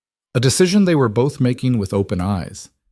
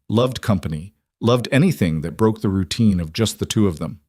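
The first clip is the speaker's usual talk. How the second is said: plain reading voice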